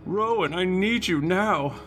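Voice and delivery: high urgent woman's plea; Ashiara voice. Acted. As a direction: call for Rowan, urgent and high. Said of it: "The Ashiara one is also, transcendent".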